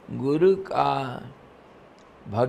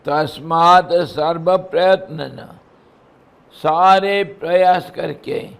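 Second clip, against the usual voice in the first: raised, chanting verse voice; scripture recitation; 3 semitones higher, 7 dB louder than usual. Acted. Guru reciting Gita verse to disciples, intoned. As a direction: intone the Sanskrit line.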